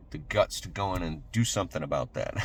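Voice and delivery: usual speaking voice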